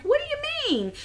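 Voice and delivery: high, startled "what do you mean"; confused surprise. Retold or imitated. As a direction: pitch it way up in disbelief. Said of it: "And I'm like".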